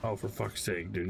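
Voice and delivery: ordinary talk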